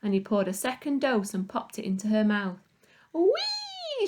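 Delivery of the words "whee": far higher delighted squeal; gleeful scream. A narrator's reading. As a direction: squeal like on a ride. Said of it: "she screamed".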